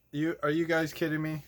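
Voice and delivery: ordinary delivery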